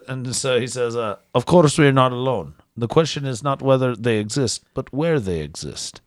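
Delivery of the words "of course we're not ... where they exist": a lightly put-on Russian accent. Podcast host quoting a Russian scientist in a mild Russian accent. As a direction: read the quote in a hint of Russian accent. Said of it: "just by the accent".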